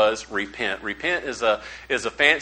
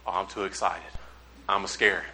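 low, hushed thinking aloud; private thought; 7 semitones lower, 9 dB quieter than usual. Retold or imitated. Preacher thinking to himself while sitting there, low and quiet. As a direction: drop low and soft, as if to yourself.

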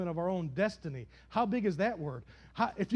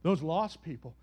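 smug believer; 7 semitones lower than usual. Retold or imitated. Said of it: "be like".

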